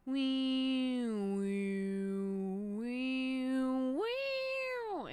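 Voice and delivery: sung "wee" jumping up an octave; octave synth imitation. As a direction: imitate the octave leap with your voice. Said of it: "there's an octave thing that they do".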